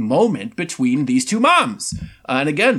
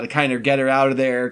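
ordinary delivery

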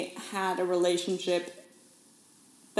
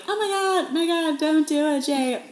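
pleading wife imitation; 7 semitones higher, 3 dB louder than usual. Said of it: "she was always like".

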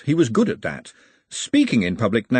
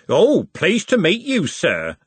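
slow, high, drawn-out parody of politeness; mocking mimicry; 8 semitones higher, 4 dB louder, slower than usual. A narrator's reading. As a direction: mimic his greeting, louder and dragged out. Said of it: "Andy mimicked".

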